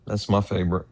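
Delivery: ordinary talk, nothing marked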